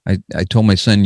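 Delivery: ordinary talk, nothing marked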